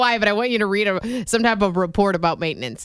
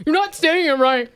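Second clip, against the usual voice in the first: sassy; 3 semitones higher than usual. Retold or imitated. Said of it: "he gets kinda, like, you know, sassy".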